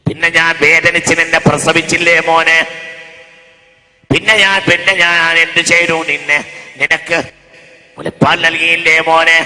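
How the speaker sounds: loud pleading mother voice; mother begging her son, preached loud. Acted.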